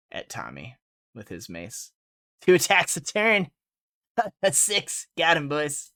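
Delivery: ordinary talk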